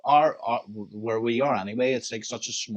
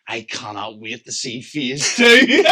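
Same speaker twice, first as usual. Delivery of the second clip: slow fan gush; 11 dB louder, slower than usual. Retold or imitated. Fan gushes to the DJ.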